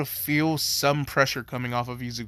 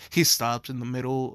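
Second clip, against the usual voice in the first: ordinary talk, nothing marked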